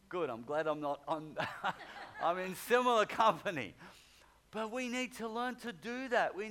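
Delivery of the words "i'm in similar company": loud words spoken through a real laugh; a laughing aside to the congregation. Real, not acted. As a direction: laugh along with the room as you say it.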